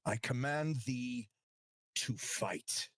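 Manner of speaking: slow, measured king's decree; warrior king. Acted. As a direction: deliver a royal command, slowly. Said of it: "before the king".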